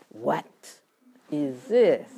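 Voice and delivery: emphasis placed on "What"; stress on What. Shown as a demonstration. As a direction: lean on the word "What". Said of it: "should I put emphasis on".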